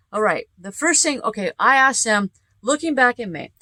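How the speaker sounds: usual speaking voice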